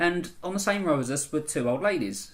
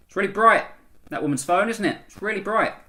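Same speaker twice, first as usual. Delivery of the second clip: louder, higher pointed remark meant to be overheard; pointed passive-aggressive remark; 7 semitones higher, 7 dB louder than usual. Retold or imitated. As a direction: say it loudly so the woman hears. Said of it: "I lost my temper a little bit".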